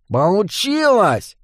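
high, drawn-out goblin cry of success; jubilant exclamation. A narrator's reading. Goblin crawling out from under table, jubilant exclamation. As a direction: exclaim in triumph, stretching the word. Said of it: "воскликнул гоблин".

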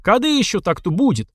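high, eager heckle; crowd shout. A narrator's reading. Onlookers urging a bigger bet, calling out high. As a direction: holler it from the crowd. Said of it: "закричали из толпы".

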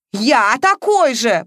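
loud, slow, indignant echo; fuming. A narrator's reading. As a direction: huff it out in outrage. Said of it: "паровозом дышал Матвеев".

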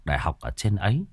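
plain reading voice

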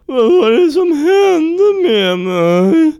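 very high, loud, slow blubbering voice; squeaky crying. Acted. Kurt waking from the drugs, blubbering high and loud. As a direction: wail it like a cartoon crybaby.

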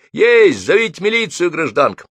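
громко и высоко, победный возглас; скомандуй, удерживая пойманного; команда. A narrator's reading. Скрутил водителя, командует. (громкая команда)

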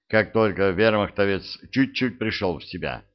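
plain reading voice

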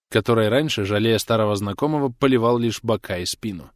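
ordinary delivery

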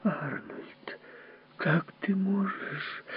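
whispered, slow, wounded; hurt gasp. Acted. Wife gasps at her husband's insult, hurt. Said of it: "растерянно ахнула".

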